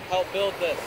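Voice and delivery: normal talking voice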